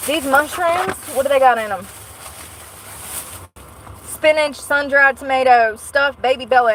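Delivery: hyped about the food; excited. Real, not acted. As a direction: rattle off the goodies with glee. Excited listing mushroom ingredients.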